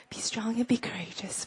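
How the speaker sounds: soft murmured recitation; quiet repeated whisper; whispered mumble. Shown as a demonstration. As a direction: whisper it under your breath. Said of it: "mumbling and also whispering back".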